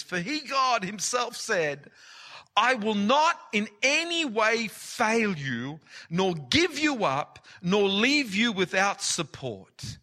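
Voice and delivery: high, loud, slow emphatic preaching; preaching at full force. Acted. Preacher thundering God's promise to the congregation. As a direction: hammer every promise home.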